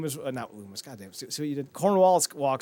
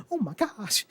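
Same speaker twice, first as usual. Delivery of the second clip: high-pitched imitated dismay; mock shocked realisation; 8 semitones higher than usual. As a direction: gasp it out high, as if just realising. Acting out Shirley's shocked realisation.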